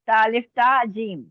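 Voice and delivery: ordinary talk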